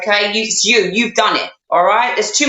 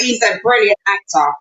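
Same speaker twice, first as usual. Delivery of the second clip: ordinary talk, nothing marked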